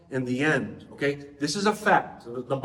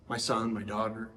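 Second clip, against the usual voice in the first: put-on softer, tender questions to a small child; gentle father; 6 semitones lower, 8 dB quieter than usual. A father on his knees asks his child for trust, softer and tender. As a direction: kneel down and ask softly.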